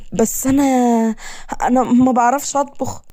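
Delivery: high, hesitant, sheepish voice; nervous admission. Acted. Woman confessing to her captor she can't cook, nervous.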